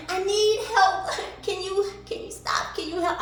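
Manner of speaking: high pleading mock whine; whiny helpless voice. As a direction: beg like a helpless damsel. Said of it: "on somebody's corner with your".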